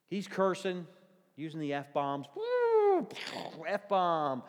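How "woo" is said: high, loud whoop standing in for a curse; hammed-up censor whoop; mock bleep whoop. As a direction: bleep out the swear word with a yelp.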